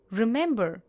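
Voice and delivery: usual speaking voice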